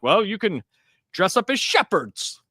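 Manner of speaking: older brother offering a pious costume idea, lightly mocked; imitating the brother. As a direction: lightly mimic the brother's preachy tone. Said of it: "and he did talk like this".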